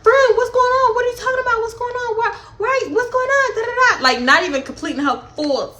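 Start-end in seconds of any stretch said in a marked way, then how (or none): 0.0-4.0 high, flustered mock of Persuasion; acting appalled. Mocking Persuasion playing innocent, high and flustered. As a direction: squeak it like a fake-shocked friend. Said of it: "Persuasion is acting all appalled".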